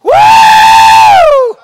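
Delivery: very high, loud whoop; ecstatic whoop. Real, not acted. Preacher whoops at the church, very high and loud. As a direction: let out a joyful woo.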